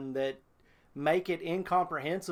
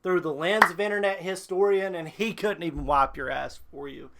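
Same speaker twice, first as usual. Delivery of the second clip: wrestling promo; 2 semitones higher, 4 dB louder than usual. Real, not acted. Calling out the fanfic author like a wrestler, loud. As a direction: cut a furious promo on the author. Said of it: "You gonna cut a promo?".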